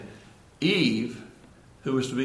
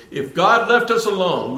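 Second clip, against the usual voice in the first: 1 forceful; 8 semitones higher, 7 dB louder than usual. Real, not acted. Preacher drives home warning.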